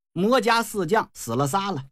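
plain reading voice

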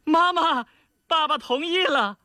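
very high, gleeful daughter calling out; joyful calling. A narrator's reading. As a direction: cry the happy news to Mum. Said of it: "欢欢喜喜地向后院大喊着".

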